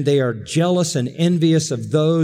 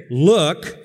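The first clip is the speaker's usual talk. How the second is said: curt, dismissive "Look" of a resentful son; complete disdain; 2 semitones higher than usual. Retold or imitated. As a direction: throw the word away with contempt. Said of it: "complete disdain".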